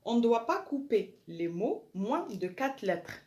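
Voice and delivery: slow, deliberate French read-out; slow dictation. Shown as a demonstration. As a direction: spell it out slowly for pupils to copy. Teacher dictates French rule.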